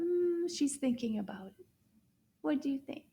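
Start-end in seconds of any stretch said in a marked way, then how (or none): none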